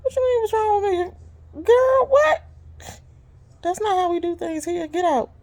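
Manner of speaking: high, cry-edged disbelief; incredulous retort. Retold or imitated. Driver answers a rude foreign passenger, high and incredulous. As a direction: shoot back in disbelief. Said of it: "And I'm like".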